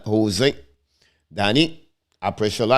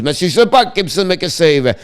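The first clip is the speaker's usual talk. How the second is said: higher, louder burst on the shot; excited call; 9 semitones higher, 8 dB louder than usual. Acted. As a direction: jump up as the chance comes. Shot and save in play-by-play.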